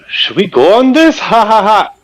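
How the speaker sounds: high, loud goofy laugh; goofy eager voice. Retold or imitated. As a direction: play the reckless buddy laughing. Friend imitated, eager laugh.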